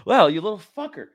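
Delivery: loud, high shout at a tick; angry yell at tick. Real, not acted. Man yells at the tick he found on himself, angry and loud. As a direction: yell at the tiny bug.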